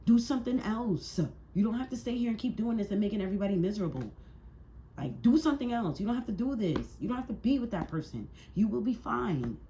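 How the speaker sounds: high, insistent plea; exasperated urging. Real, not acted. Reader telling an arguing couple to split, exasperated.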